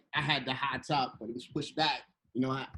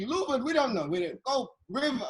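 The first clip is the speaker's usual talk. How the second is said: higher, puzzled mock French accent; bad Parisian accent; 7 semitones higher than usual. Locals unsure where the Louvre is, bad Parisian accent. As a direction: imitate clueless Parisians in a rough French accent. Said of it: "that's a horrible, uh, Parisian accent".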